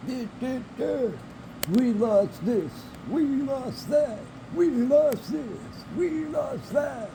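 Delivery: high, slow, whining mock cry; mocking whiny voice. Mocking whiners complaining about losses.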